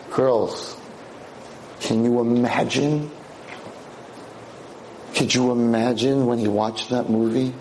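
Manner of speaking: ordinary delivery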